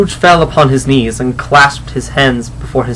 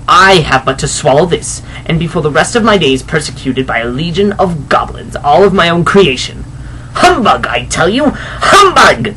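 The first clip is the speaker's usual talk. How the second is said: defiant Scrooge; 3 semitones higher than usual. Acted. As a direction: spit out the humbugs, rising in pitch.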